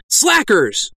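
high, sharp rebuke; scolding cry. Acted. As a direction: cry out at lazy penitents. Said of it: "I cried".

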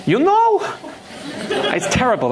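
giggling through the words, sheepish; embarrassed giggly build-up; laughing at herself. Real, not acted. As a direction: laugh it off before the impression. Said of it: "Can I do it?".

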